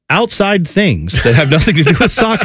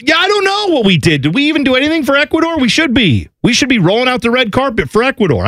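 excited outrage; 11 semitones higher than usual. Real, not acted. Mock outrage about neglecting Ecuador.

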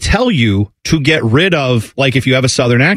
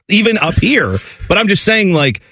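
usual speaking voice

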